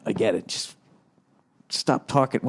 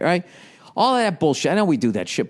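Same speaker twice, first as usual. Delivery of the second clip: ordinary talk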